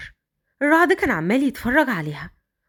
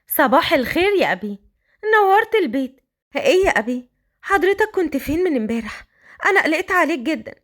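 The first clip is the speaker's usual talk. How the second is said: put-on higher, young voice; son's voice, higher; 4 semitones higher than usual. The children welcoming their father home, higher young voice. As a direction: greet your father brightly.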